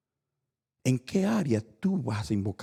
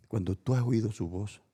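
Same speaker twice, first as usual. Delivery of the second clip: ordinary talk, nothing marked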